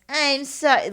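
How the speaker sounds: high, loud, mock-sorry squeal; babyish receptionist voice. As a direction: imitate a sugary baby-voiced apology. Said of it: "This was her voice".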